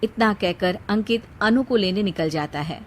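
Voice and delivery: ordinary talk, nothing marked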